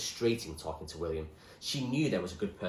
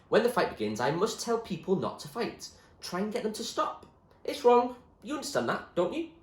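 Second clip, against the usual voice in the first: put-on higher boyish voice, firm and sincere; William, earnest; 10 semitones higher, 6 dB louder than usual. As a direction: explain calmly why he stays. William explains his stand.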